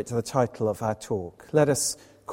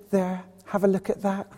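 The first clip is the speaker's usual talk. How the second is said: put-on higher-pitched old Scottish lady; imitated old lady's accent; 5 semitones higher than usual. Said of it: "forgive my accent".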